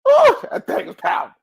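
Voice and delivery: high, loud burst of awe; excited awe. Real, not acted. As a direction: exclaim it, really bowled over. Repeats awed reaction with force.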